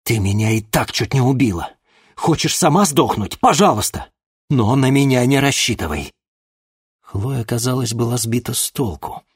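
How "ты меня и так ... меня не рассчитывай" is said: angry. Acted. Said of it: "всерьёз рассердился".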